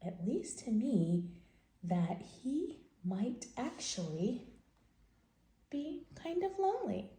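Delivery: lively picture-book read-aloud voice; reading to children. Acted. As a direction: tell the story to little kids.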